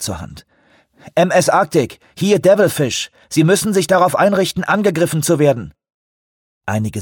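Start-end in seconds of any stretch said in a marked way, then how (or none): 1.1-5.7 over walkie talkie. A narrator's reading. Radio call to ship.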